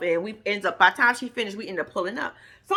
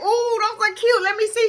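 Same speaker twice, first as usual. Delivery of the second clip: excited cooing; 10 semitones higher than usual. Retold or imitated. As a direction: coo over someone's new boots. Cooing over boots pulled from bag.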